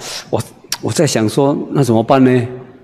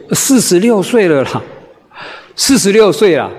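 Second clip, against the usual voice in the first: chatty wife; 5 semitones higher than usual. Retold or imitated. Wife answers preacher.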